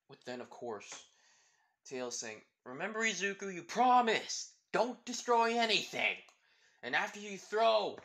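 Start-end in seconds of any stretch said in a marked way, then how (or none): 2.6-8.0 high, loud urgent warning; shouting. Acted. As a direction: yell the reminder across the field.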